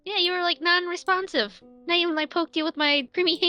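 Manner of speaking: ordinary talk, nothing marked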